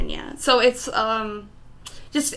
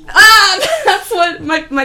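laughing; 9 semitones higher, 11 dB louder, slower than usual. Real, not acted. Fiance walks in.

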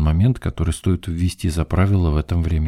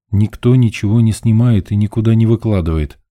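plain reading voice

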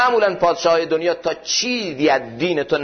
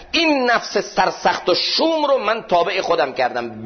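raised, slow preaching climax; fervent sermon peak, higher and drawn out; impassioned preaching; 7 semitones higher, slower than usual. Acted. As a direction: preach it high and slow with fervour.

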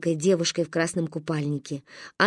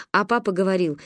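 usual speaking voice

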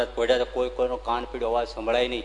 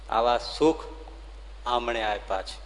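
ordinary delivery

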